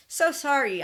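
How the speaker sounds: a loud, high, sarcastic "so sorry"; mock apology. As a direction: apologize without meaning it at all. Mock apology, then "not sorry.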